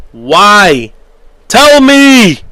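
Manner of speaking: loud demand leaping high in pitch; exasperated shout. Real, not acted. Fan demanding answers from Chelsea, exasperated shout. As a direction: build from loud to a full yell.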